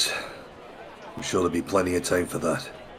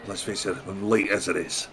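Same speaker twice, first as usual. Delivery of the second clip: ordinary delivery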